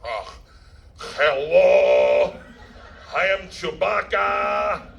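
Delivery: slow, drawn-out Chewbacca call; imitating someone's hammy Chewbacca voice; Chewbacca impression. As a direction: do a badly faked Chewbacca, calling out the words. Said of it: "do your Chewbacca impression".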